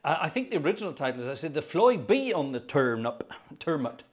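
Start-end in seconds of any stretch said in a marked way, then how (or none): 1.5-4.0 rustic Wiltshire dialect, "fly" as "floy"; Wiltshire accent. Shown as a demonstration. Organist recalling the song's original title, Wiltshire accent. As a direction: quote it with a put-on Wiltshire country accent. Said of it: "about as good as my Wiltshire accent gets".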